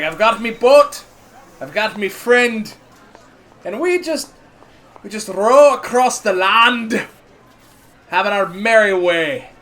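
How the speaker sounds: a hint of a proud sailor dwarf bragging about his boat; dwarf boasting. Dwarf brags to the party about his land boat, lightly voiced. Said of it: "He's like".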